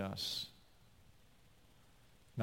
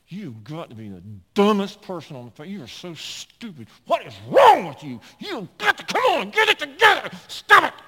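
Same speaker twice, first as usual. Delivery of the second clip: high, loud berating of oneself; self-scolding; 6 semitones higher, 12 dB louder than usual. Retold or imitated. Driver scolding himself while driving, angry. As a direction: chew yourself out in the car.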